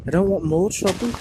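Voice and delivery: ordinary delivery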